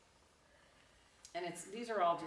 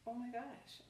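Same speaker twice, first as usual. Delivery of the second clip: higher, hushed mock astonishment; startled monk; 6 semitones higher, 4 dB quieter than usual. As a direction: play the monk taken aback. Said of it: "And the monk is like".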